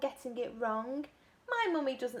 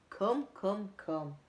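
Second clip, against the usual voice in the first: plain reading voice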